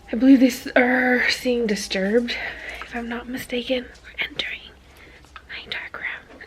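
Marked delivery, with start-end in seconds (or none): 2.8-6.4 unvoiced whisper, entering a dark room; hushed whisper in the dark. Real, not acted. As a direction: whisper so nobody wakes.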